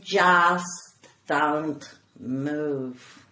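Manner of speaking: very slow, deliberate low warning. Retold or imitated. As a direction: stretch each word, dead serious. Ranger with shotgun, tense warning.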